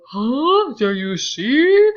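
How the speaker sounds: smug gotcha to the room; gloating Tom. Retold or imitated. Teacher imitates Tom gloating at the table, loud slow gotcha. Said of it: "Imagine him going like".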